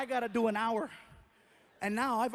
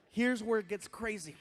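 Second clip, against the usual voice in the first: usual speaking voice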